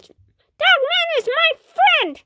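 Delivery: put-on high, slow, pleading kid cry; insistent kid. Kid defending his friend to papa, high pleading. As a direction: insist like a hurt little kid.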